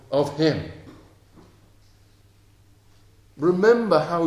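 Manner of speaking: loud, high, impassioned preaching; preaching at full force. Acted. Preacher urging the congregation to think of God, rising to full force. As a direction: drive it home to the congregation.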